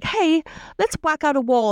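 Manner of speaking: a perky put-on Lisa; hint of chirpy host; mock Lisa voice. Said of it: "she's like".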